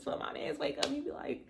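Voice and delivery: ordinary delivery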